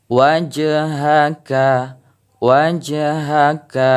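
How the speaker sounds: slow "wajhaka" with the j bouncing; qalqalah on jim. Shown as a demonstration. Teacher demonstrating qalqalah, bounced jim. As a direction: let the jim in "wajhaka" rebound. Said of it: "pantulan huruf jim ini terdengar".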